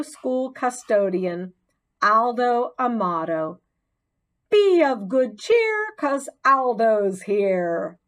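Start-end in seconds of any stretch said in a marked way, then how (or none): 4.5-8.0 high, loud jolly call, slowing on the rhyme; hearty rhyming greeting; cheerful announcement. A narrator's reading. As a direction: announce yourself to the room like a friendly janitor. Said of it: "a voice announced".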